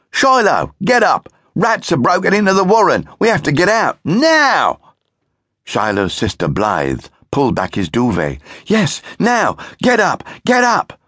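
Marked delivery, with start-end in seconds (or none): 0.1-4.8 high, loud urgent shout. Acted. Brother shouts in Shiloh's ear about rats, loud and high. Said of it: "Maximilian shouting in his ear". 8.7-11.0 panicked sister. Acted. Sister panicking about rats.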